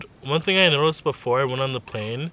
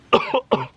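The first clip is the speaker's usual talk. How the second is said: sudden harsh cough; coughing fit; 13 semitones higher, 6 dB louder than usual. Real, not acted. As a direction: cough hard mid-sentence. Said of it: "Sorry, it's".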